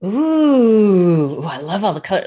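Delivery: delighted loud ooh; excited. Real, not acted. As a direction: gush over the colours. Delighted at card art.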